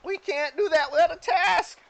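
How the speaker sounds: high, loud cartoon protest; Mister Meeseeks. Game master as Meeseeks refusing an order to disappear, high Meeseeks voice. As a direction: object loudly in a squeaky helper voice. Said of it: "he orders them to disappear".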